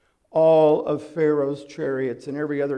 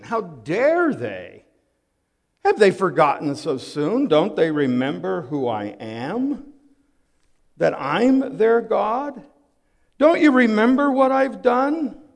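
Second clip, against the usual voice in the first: rising, louder, higher indignation; indignant rebuke; 5 semitones higher, 6 dB louder than usual. Acted. God indignant at his forgetful people, rising rebuke. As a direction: build the outrage to the last line. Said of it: "a question of condemnation".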